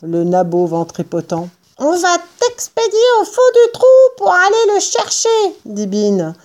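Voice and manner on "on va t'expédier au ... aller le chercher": very high, rapid character voice; threatening farmer. A narrator's reading. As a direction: threaten fast in a high-pitched voice. Bean menace Buns, voix aiguë. (menace rapide, voix suraiguë)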